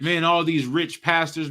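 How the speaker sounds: normal talking voice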